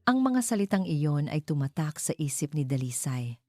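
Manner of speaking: ordinary delivery, nothing marked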